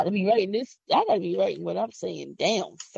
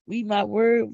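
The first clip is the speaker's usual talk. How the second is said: ordinary talk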